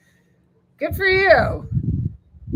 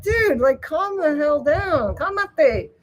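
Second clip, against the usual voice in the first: higher, mock-scolding dog owner; playfully telling an overexcited dog to calm down; 6 semitones higher, slower than usual. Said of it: "and I'm like".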